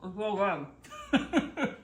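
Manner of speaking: talking through a mouthful; chewing noisily. Real, not acted. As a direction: chew while you speak. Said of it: "I'm sorry I'm eating right now".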